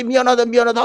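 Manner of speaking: high-pitched acted apology; frantic apologising. Acts parent apologising to child.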